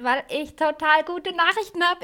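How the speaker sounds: high, loud burst of excitement; hyped up. Real, not acted. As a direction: blurt the good news all wound up. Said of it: "Warum bin ich aufgedreht?".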